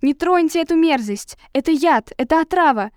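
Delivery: high, urgent warning cry; alarmed shout. A narrator's reading. Savage warns crowd about poison.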